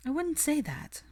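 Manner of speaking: high, tentative pushback; Hermione's voice. Acted. Hermione answers Kingsley.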